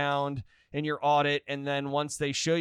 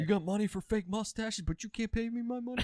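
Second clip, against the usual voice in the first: mock creditor; 7 semitones higher, 4 dB quieter than usual. Acts out a creditor's demand.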